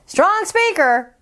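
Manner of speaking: plain reading voice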